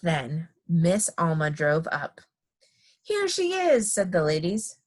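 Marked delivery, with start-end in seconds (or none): 3.0-3.9 high-pitched group greeting; excited announcement. A narrator's reading. Ladies as Miss Alma drives up, high excited call. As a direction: announce her arrival up high.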